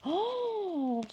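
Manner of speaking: long, high "oh" of wonder; soft, high cry of amazement; delighted gasp. Real, not acted. Découvre une nouvelle carte, émerveillée. (oh ravi, long et aigu)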